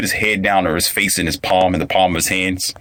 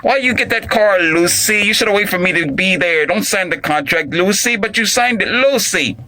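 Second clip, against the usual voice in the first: high-pitched mock scolding of his wife; hammed-up fed-up husband; exasperated husband scolding; 9 semitones higher than usual. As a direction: scold her in a raised, put-on husband voice. Acting husband scolding Lucy over spending.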